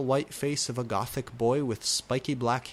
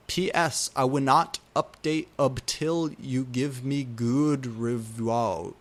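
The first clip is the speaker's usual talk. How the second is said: ordinary delivery, nothing marked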